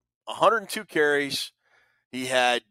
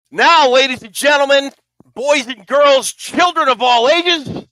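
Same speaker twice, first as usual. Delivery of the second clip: booming ringmaster announcement, much higher and louder; 10 semitones higher, 10 dB louder than usual. Acted. Big board intro, circus announcer.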